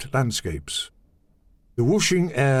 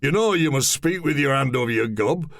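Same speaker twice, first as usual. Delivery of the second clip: tall man's voice; 6 semitones higher than usual. Acted. Held voice of the tall man.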